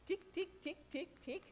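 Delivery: high ticking mind, tick tick tick; playful clock ticking for the congregation. Shown as a demonstration. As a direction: click out each tick like a clock. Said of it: "That little mind's going what?".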